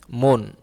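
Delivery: teacher modelling "মন" with an o vowel; model pronunciation. Shown as a demonstration. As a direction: say "মন" as "mon", the অ like ও. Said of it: "উচ্চারণটা হয়েছে ম ওকার".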